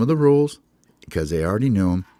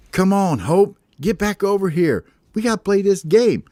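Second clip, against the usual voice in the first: much higher, louder bird voices; frustrated teammates; 11 semitones higher, 4 dB louder than usual. Acted. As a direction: birds impatient for their captain. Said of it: "and they're like".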